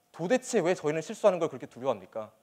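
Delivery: ordinary delivery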